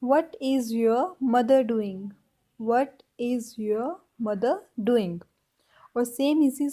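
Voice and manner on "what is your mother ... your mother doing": very slow, each word spaced out; slow English dictation; slow teaching demo. Shown as a demonstration. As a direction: say it slowly twice for repeating. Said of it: "इसको हम English में कैसे बोलेंगे".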